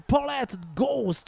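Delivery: normal talking voice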